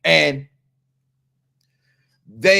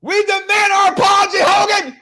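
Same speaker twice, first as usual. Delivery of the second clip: outraged shout; 14 semitones higher, 7 dB louder than usual. Acted. Host yells at Hogan for an apology, outraged shout. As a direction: shout it like demanding justice. Angry rant at Hogan over sound clip.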